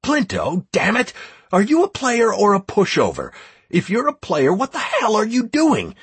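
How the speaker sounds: high, heated rebuke; angry dressing-down. A narrator's reading. Leader berating Plinto and would-be deserters, angry. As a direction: scold them hard and drive them back in line.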